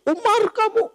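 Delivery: high, loud rude shout at Umar; rude call-out. Retold or imitated. Bedouin rudely confronts the caliph Umar with a high loud call. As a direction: bark the name like a brazen Bedouin.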